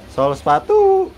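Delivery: very high, slow street call; shoe mender's cry. Shown as a demonstration. Owner mimics a cobbler for bird, high drawn-out cry. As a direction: hawk it way up high, last word held.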